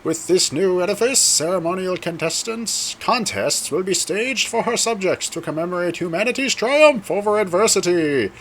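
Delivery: high, ringing herald's announcement; royal proclamation. Acted. Herald invites guests to the Queen's Jubilee, formal proclamation. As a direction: proclaim it grandly to the Queen's subjects.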